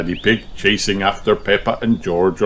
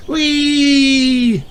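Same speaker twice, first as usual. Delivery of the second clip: high squeal; gleeful whee; 4 dB louder than usual. Acted. Children scooting off, squealing. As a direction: squeal riding off.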